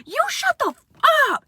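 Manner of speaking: shrieked very high; mock furious yell; furious shout. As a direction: scream shut up at her. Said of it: "she was like".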